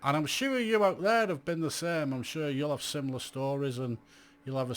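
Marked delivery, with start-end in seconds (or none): none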